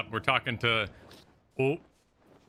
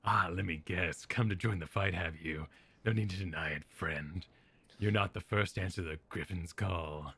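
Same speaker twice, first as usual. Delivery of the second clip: low, softer gravel of a resistance leader; a soft-spoken rebel leader, lower; low, quiet rebel voice; 7 semitones lower, 7 dB quieter than usual. Acted. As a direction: pitch it down and keep it hushed.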